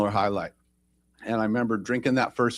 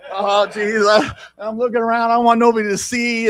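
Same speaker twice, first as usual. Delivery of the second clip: flustered; 8 semitones higher, 5 dB louder than usual. Retold or imitated. Flustered looking around.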